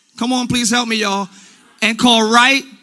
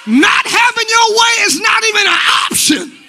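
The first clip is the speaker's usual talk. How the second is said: loud, higher preacher's shout; preaching at full force; 9 semitones higher, 4 dB louder than usual. Acted. Pastor loudly rebuking stubborn believers. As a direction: hammer the point home to the church.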